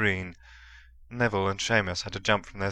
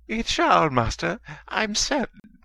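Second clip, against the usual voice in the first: high, fawning simper; simpering servant; 12 semitones higher than usual. A narrator's reading. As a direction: grovel in a thin high voice. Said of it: "Wormtail simpered".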